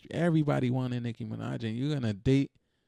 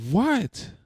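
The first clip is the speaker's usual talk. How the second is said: high, loud, sharp what of disbelief; incredulous what; 9 semitones higher, 5 dB louder than usual. Real, not acted. Ranting about footballers paying women, loud incredulous what. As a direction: jump up in pitch, baffled.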